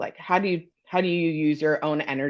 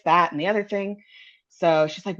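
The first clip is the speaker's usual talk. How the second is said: ordinary talk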